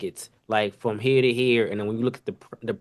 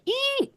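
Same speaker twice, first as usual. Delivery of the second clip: very high squeaky eat; printer squeal; 3 dB quieter than usual. Retold or imitated. Cook mimicking the order printer, a tiny high squeal. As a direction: squeal it like a machine beeping.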